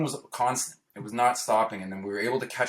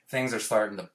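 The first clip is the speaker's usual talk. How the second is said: usual speaking voice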